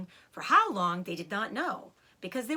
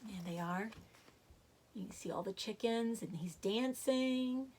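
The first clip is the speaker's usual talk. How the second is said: quiet aside; 7 dB quieter than usual. Real, not acted. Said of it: "narrator aside showing picture".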